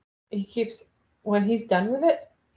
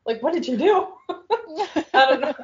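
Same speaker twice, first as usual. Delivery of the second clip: laughing; 7 semitones higher, 3 dB louder, faster than usual. Real, not acted. Laughing at the scolded Alexa.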